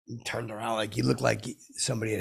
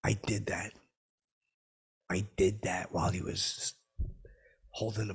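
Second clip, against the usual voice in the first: whispered confession; 4 dB quieter than usual. Real, not acted. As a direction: admit it under your breath. Confessing the fart.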